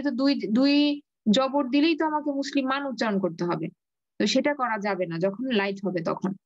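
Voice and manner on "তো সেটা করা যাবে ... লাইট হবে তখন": ordinary talk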